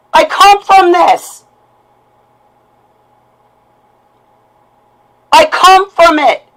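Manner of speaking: high, loud and slow, hitting come; real fury with pain under it; anguished shout. Real, not acted. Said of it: "what you just heard is a lash out".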